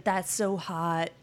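drawn-out mock flirting; slow flirty praise. Matches flirting over credit score, slow teasing. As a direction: stretch it, teasing. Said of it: "everybody was matching with her saying".

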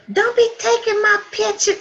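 high, loud mock-fussy mother; imitating mother. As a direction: squeal her protest like a camera-shy mom. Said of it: "she gonna say".

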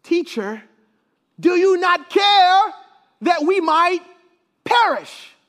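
high, loud, drawn-out plea; pleading disciples. Acted. Disciples appealing to sleeping Jesus in the storm, pleading loudly. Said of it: "turn to him and they say".

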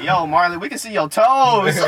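loud, high teasing holler; imitated heckle, drawn out; mock calling out. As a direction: call him out, stretching the last word. Imitates someone calling out his toes.

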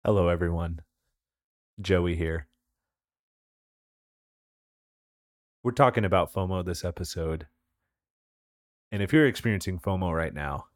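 deadpan calm advice with a joke inside; mock soothing advice. Joey gives listeners absurd FOMO advice, low and soothing.

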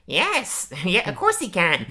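high, lightly voiced mock Marge; a kid faking mom's voice, lightly; a hint of Marge, playfully; Bart imitating Marge. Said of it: "Bart doing an impression of Marge".

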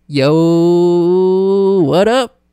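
loud, long drawn-out hail; mock greeting. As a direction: pretend grand entrance, stretching the first word. Acted entrance onto film set.

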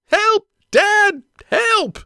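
put-on loud, very high-pitched girl's scream; screamed cry for help. A narrator's reading. Daughter hollering for Dad with a fish on, screamed. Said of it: "she screamed".

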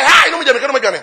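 high, loud startled "Ha" then pleading; hammed-up fright, begging to be let go; mock frightened cry. Frightened person crying out as preacher retells, high and loud. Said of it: "Wo nya sɛ".

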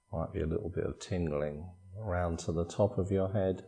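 soft, unhurried body-scan voice with long pauses; guided meditation. Acted. Meditation teacher guiding listeners through a body scan, calm guided meditation. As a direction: calmly guide them through relaxing, phrase by phrase.

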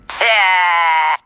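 sudden loud frustrated yell; loud frustrated outburst; frustrated shout. Real, not acted. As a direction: let out a sharp angry shout.

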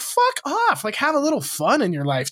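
high-pitched exasperated retort; retold exasperation. Venting at the killjoy older man, high-pitched exasperation. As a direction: throw the dismissal out high, then ease down.

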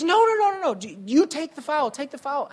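retold dad insisting, fading at the end; dad overriding. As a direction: cut the kid off like a coach. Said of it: "My dad says".

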